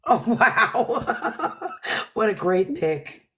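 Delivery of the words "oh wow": laughing through the words; amused, words stretched by laughter. Real, not acted. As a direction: break into laughter while reacting. Cracking up on the stream.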